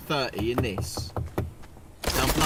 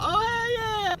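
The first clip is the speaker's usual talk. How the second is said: much higher, sing-song mock of a carefree woman; mocking the ex-wife; 10 semitones higher than usual. Mocking the dead friend's ex-wife to his mates, high sneering impression. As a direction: sneer as you put on her airy voice. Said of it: "She's like".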